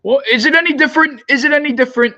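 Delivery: very high, loud incredulous yell; real fan exasperation; exasperated yell. Real, not acted. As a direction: yell the question at the screen in disgust.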